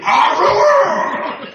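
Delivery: loud, high cartoon sneeze; big cartoon sneeze. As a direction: blast out a sneeze. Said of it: "Where I'm like".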